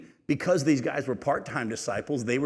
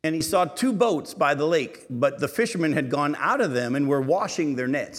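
raised, elevated Bible reading voice; scripture reading; 3 semitones higher, 4 dB louder than usual. Acted. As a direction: read the Gospel out to the congregation.

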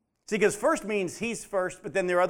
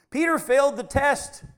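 emphatic declaration; 8 semitones higher, 6 dB louder than usual. Real, not acted. Preacher emphatic point.

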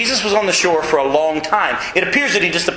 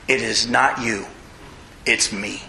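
soft; 7 semitones lower, 5 dB quieter, slower than usual. Acted. Jesus voiced softly.

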